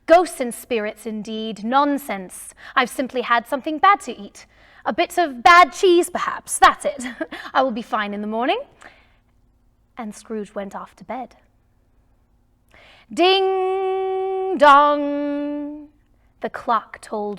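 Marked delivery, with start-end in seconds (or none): 0.1-8.6 loud, fast scoffing with a laugh; dismissive Scrooge. Acted. Scrooge brushes off the ghost. 13.1-15.9 long, ringing bell sounds; voiced clock chime.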